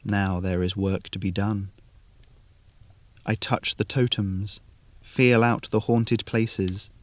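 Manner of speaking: usual speaking voice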